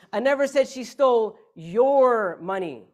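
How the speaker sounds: "your" drawn out long and loud; slow, loud build to a stretched "your"; stress on YOUR. Shown as a demonstration. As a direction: hit "your" hard and hold it. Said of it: "depending on which word you emphasize".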